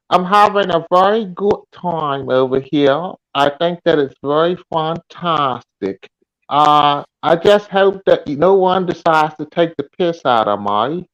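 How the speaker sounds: American trying on a British accent; attempted British accent. Shown as a demonstration. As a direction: have a go at sounding British. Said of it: "I'm gonna try my accent out".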